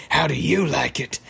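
put-on gloating wizard voice. Wizard jeers while throwing eggs at Rigby, mock gloating. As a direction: jeer in a cartoon villain voice. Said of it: "the wizard's throwing eggs at him".

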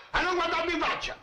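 high, furious order; angry shout. Acted. Barber yelling after the apprentice, high angry shout. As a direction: bark it at the boy's back.

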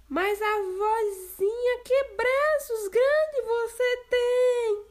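put-on amazed girl. Girl marvelling at grandma's arms.

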